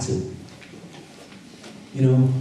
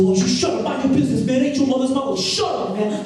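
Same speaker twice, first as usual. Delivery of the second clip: high-pitched, heated retort; quick mock-angry comeback, pitched high; acted argument; 11 semitones higher than usual. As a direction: bicker back, imitating a squabble between friends. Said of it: "we started going at it".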